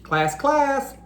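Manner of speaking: high teacher call for attention; teacher calling class. Acted. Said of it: "Missus Wimpy Dimple asked the class".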